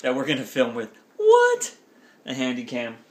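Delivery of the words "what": very high, loud What; shocked squeal. Pretend. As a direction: yelp it in mock shock.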